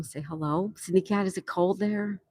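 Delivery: ordinary talk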